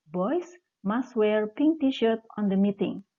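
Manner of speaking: slow, clear teaching read of the example sentence; slow classroom demo. Shown as a demonstration. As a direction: read the example slowly so pupils can follow it. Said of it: "Contoh, ya".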